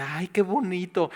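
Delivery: high, breathy-free mock delight; gushing newcomer. Newcomer gushing over the church, mock delight. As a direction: coo it like a dazzled first-time visitor.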